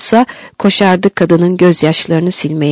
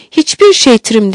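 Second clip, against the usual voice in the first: plain reading voice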